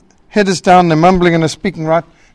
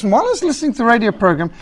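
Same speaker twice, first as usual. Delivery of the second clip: rushed; 2 semitones higher, much faster than usual. Retold or imitated. Impatient listener, rushed.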